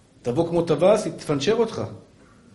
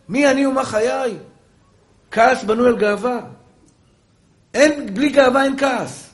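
loud, higher-pitched sermon delivery; impassioned preaching; 10 semitones higher, 8 dB louder than usual. Acted. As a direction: preach it forcefully to the congregation. Preacher at full force.

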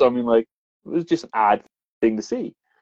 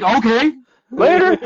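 high, louder, flippant okay later; mock carefree sign-off; mock breezy goodbye; 4 dB louder, slower than usual. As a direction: brush it off with a cheeky wave-off. Mock dismissive reply retold.

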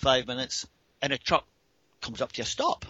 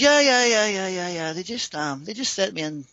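eager tipsy babble, pitched up and tumbling out; imitated tipsy woman; 6 semitones higher than usual. Tipsy woman brushing off his question as she climbs aboard, imitated eager babble. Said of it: "she actually is pretty intoxicated".

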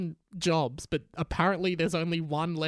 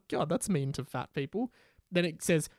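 normal talking voice

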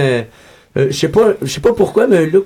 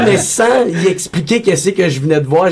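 ordinary talk, nothing marked

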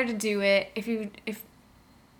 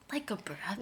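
unspoken afterthought; 10 dB quieter than usual. Acted. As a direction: slip it in under your breath. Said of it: "didn't actually say it out loud".

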